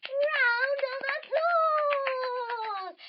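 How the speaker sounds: very slow, high and loud; drawn-out big finish. A narrator's reading. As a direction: stretch it like a showman. Audience applause announced.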